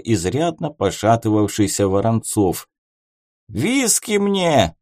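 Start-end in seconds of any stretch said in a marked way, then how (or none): 3.5-4.7 put-on slow drunken yell; belligerent drunk. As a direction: bellow it, drunk and rowdy. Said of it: "пьяно заорал он".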